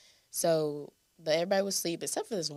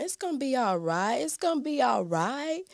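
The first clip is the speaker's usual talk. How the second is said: mock soothing; 7 semitones higher than usual. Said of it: "this one lady talking to me, telling me".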